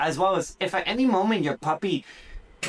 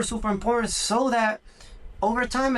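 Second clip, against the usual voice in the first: normal talking voice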